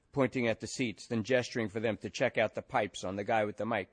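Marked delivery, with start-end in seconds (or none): none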